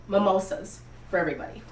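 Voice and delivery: ordinary talk, nothing marked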